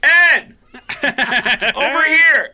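high, loud shout with a laugh; loud cheerful call across a room. Retold or imitated. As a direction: hail him across the room. Calling across the bowling alley.

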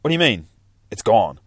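loud, rising shock at the news; loud stunned disbelief. Acted. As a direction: hit it like the floor just dropped out. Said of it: "And she's like".

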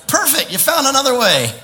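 high, loud, amused approval; hammed-up enthusiasm; mock delight. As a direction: celebrate the excuse as great news. Mock cheering at someone's awkward excuse.

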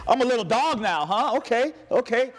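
high-pitched, hammed-up affront; mock indignation. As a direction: act insulted and ready to fight. Acting out insulted person readying to fight.

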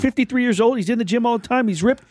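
high-pitched guy asking around about someone; gossiping guy. Pretend. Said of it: "I'll be the guy where be like".

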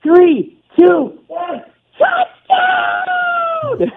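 high-pitched squealed countdown into a drawn-out touchdown; mock scream, climbing far above your normal pitch; imitated child's scream. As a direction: mimic a kid screaming a touchdown call. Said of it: "she just basically screams in the phone".

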